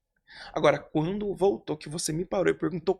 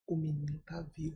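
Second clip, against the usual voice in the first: soft whispered line, much softer; 3 semitones lower, 11 dB quieter than usual. Retold or imitated. Woman recalls the pastor's words, hushed whisper. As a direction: breathe the words like a secret.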